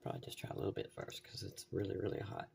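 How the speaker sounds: soft, half-whispered aside; muttering to yourself before a bite; quiet murmur. Real, not acted. About to taste hot food.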